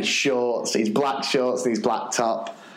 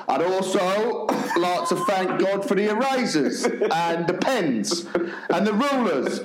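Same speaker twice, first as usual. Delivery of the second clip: slow, higher, desperate listing; stalling prayer list; 5 semitones higher, slower than usual. Retold or imitated. Panicking teacher listing a pencil case in prayer, slow desperate stalling. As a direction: drag out each item as you scramble. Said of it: "he started listing basically a pencil case".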